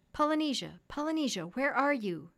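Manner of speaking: calling out. A narrator's reading. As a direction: call out for someone missing. Doctor calls for missing parrot.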